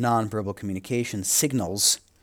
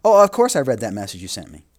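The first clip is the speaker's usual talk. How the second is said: louder, breezy fake reassurance; 9 dB louder than usual. Lecturer to class, acting out a face-saving lie, louder. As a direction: fib brightly to save face. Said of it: "you may lie to save your own face".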